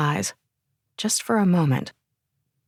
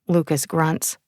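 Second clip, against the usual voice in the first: ordinary delivery, nothing marked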